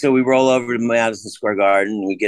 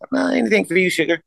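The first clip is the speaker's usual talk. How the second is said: much higher, playful ticket-lady voice; imitating older woman; 10 semitones higher, faster than usual. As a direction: pitch way up as an obliging older lady.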